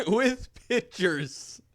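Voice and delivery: slow laughing repeat. Real, not acted. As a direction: laugh through the words.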